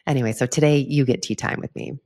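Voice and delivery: ordinary delivery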